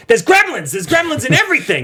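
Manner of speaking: panicked yelling. Retold or imitated. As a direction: yell a frantic warning. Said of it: "He's the neighbor in Gremlins who's like".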